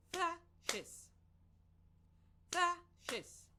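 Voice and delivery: Thrashes split into two even beats, THRASH-es; clapped syllables. Shown as a demonstration. Teacher modelling syllables for the class, beat by beat. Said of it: "while I clap the syllables".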